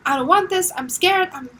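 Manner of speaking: put-on a small child begging in fear; scared little child. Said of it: "See this as a little child".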